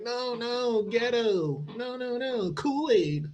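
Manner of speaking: high, mocking sing-song voice rejecting the best; high, soft mock voice. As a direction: sneer it like a spoiled kid choosing junk. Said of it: "You're like".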